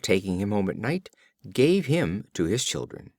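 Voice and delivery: plain reading voice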